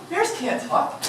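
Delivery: plain reading voice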